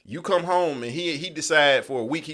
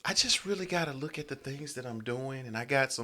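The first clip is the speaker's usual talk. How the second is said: lightly voiced girl, soft and lower; a hint of a woman talking; man doing a woman's voice; 3 semitones lower, 11 dB quieter than usual. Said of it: "That's my girl voice".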